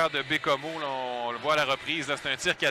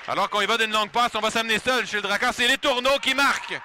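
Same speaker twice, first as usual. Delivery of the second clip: higher, louder breakaway call; excited goal call; 6 semitones higher, 5 dB louder than usual. Acted. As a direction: build to the goal. (appel de but excité)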